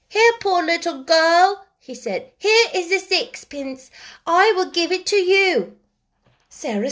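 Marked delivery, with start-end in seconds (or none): 0.1-1.7 put-on high, slow, loud child's voice; piping small boy, drawn-out and earnest; little boy. A narrator's reading. Small boy offering a poor girl charity, earnest child voice. 2.4-5.8 put-on little boy. A narrator's reading. Small boy offering a poor girl charity, earnest child voice.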